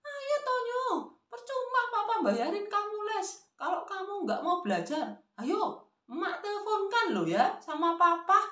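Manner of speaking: very high-pitched threatening grandma; acted granny's high-pitched nagging; rising scolding pitch. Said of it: "Si nenek pun semakin meninggi nadanya".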